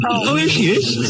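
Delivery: slow, high put-on old woman's voice; old woman impression. Doing his old lady bit for his mate, slow and high. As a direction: drawl it out high like a gossipy old dear. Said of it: "Do you still do that one".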